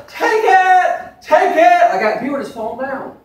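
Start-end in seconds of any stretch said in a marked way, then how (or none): 0.1-1.8 sharp high hammed-up yell; mock shout. Imitating the preacher shouting at his crowd. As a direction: fling the command out at the crowd. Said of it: "He goes".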